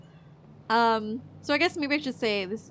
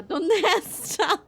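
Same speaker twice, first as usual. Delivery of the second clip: laughing mock Spanish; 9 semitones higher, 6 dB louder, much slower than usual.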